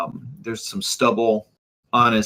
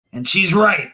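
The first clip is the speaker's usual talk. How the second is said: higher, louder burst of guilt; anguished admission; bitter self-blame; 7 semitones higher, 6 dB louder than usual. Acted. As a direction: blurt out the painful admission. Guilty magistrate admits blame.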